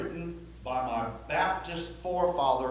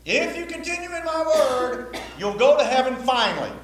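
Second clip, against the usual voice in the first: mock the wrong reading with a silly voice; mock false-gospel voice; 8 semitones higher, 6 dB louder than usual.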